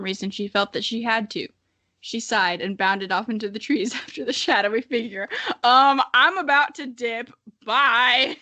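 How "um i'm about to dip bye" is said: louder, higher, slow casual goodbye; breezy, like ducking out of a party; flippant modern teen. Pretend. Narrator mocking her wolf heroine, casual goodbye. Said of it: "mock voicing Ice Claw leaving".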